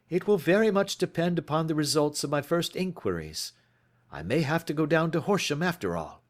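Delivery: crisp, clipped gentleman sleuth, lightly done; lightly voiced Holmes. A narrator's reading. Holmes greets Watson at breakfast, lightly voiced.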